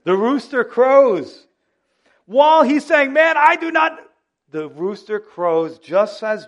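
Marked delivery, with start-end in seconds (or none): 0.0-1.5 loud, slow, high call of the rooster; dramatic rooster crow line. Acted. Preacher booming the rooster crowing to the church. 2.3-4.0 much higher, louder, racing to the cut-off; breathless rising climax. Acted. Preacher acting the rooster interrupting Peter, cutting in high and loud.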